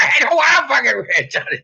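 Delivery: hammed-up outraged yell; mock shout. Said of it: "You're like".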